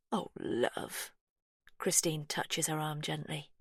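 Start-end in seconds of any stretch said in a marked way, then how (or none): 0.1-1.1 soft, low, breathy sympathy; tender comfort. Acted. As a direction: comfort her gently. Christine comforts Laurie gently.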